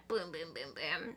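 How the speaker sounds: low, soft boom boom; quiet gun noises to himself; muttered sound effects. Retold or imitated.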